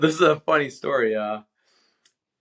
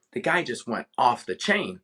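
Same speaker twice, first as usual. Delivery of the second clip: ordinary talk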